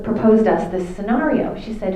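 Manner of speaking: normal talking voice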